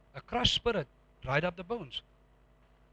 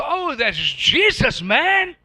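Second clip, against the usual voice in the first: slow, loud and high, rejoicing; triumphant testimony; 8 semitones higher, 8 dB louder, much slower than usual. Retold or imitated. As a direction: savour every word of the victory. Preacher answers with triumphant testimony.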